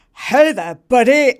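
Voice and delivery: blokey buddy voice. Retold or imitated. Same volunteer greeting a boy, a hint of hearty bloke. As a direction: puff up a lightly played big-guy hello. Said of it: "voice drops a full octave".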